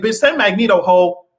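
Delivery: ordinary delivery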